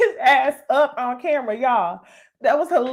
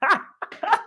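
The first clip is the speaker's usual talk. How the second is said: laughing; 8 semitones higher than usual. Real, not acted. Laughing at being called out.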